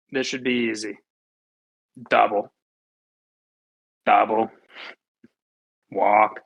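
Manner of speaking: low, resigned deadpan with long pauses; deadpan dismay. Retold or imitated. Recounting the inning falling apart, grimly deadpan. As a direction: drop each word low and let it hang.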